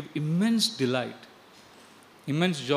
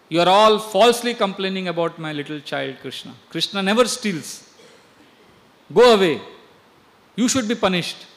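louder scolding, a sharp shout on go away; scolding mother; 3 semitones higher, 8 dB louder than usual. Acted. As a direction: drive them off with a raised voice. Yashoda scolds the gopis.

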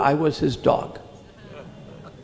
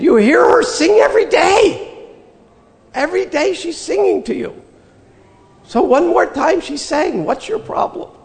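much higher, louder, shouted rebuke; retold shout; 12 semitones higher, 5 dB louder than usual. Retold rebuke of firestorming devotees.

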